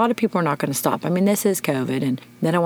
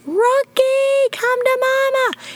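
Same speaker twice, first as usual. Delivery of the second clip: much higher, louder call, slow on mama; screaming; 4 dB louder, much slower than usual. Retold or imitated. Owner calling the runaway puppy home, screaming. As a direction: call him from far down the street. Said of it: "I scream".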